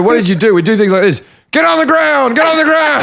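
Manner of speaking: hammed-up cop yelling get on the ground; reenacting cops. Comic retelling a TV crime reenactment, mock cop yell. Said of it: "they go".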